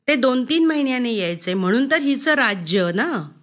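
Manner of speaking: usual speaking voice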